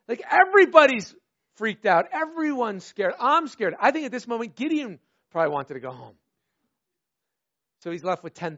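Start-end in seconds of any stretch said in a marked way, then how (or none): none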